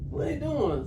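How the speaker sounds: high, loud excited peeping question; buzzing with nosy glee; nosy excitement. Retold or imitated. Peeping friend asking what the man is doing, excited.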